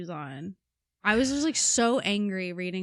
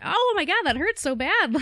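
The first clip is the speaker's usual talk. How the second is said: high hammed-up fake yelp of pain, louder; mock pain; 10 semitones higher, 5 dB louder than usual. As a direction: play-act hurting to get a laugh. [name] jokingly fakes pain.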